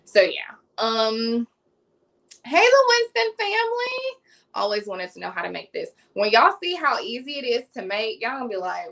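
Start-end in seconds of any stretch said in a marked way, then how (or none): 2.4-4.2 high, loud greeting; calling out a greeting. Real, not acted. Host greets a family joining her live, calling out high. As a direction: call out a big hello to viewers.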